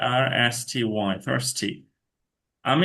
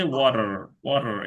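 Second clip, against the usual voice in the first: ওয়াটার in an American accent with a flapped t, like wora; American water. Shown as a demonstration. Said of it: "আমেরিকানে হচ্ছে".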